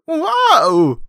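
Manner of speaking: very high, loud comic wail; Don Knotts. Acted. Neighbor scared by the leprechaun, high mock wail. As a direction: let out a long startled falsetto yelp. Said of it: "That's my Don Knotts impression".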